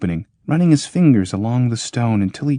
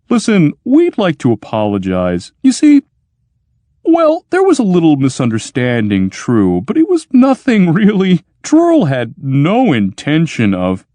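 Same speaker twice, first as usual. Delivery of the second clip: higher, louder nervous babble; nervous pleading; 8 semitones higher, 6 dB louder than usual. Acted. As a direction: talk fast to smooth it over. Clopasius nervously apologizing to machine.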